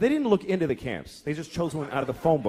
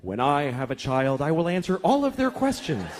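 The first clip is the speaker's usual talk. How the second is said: judgmental onlooker. Acted. Childless onlooker judging a mother, sanctimonious. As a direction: build into a lofty, preachy vow. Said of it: "And you think".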